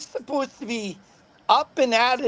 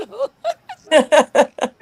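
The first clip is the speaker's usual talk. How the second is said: loud high laughter; real laughter at the memory; laughing fit; 6 semitones higher, 8 dB louder than usual. Real, not acted. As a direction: burst out laughing. Laughter after the sheriff quote.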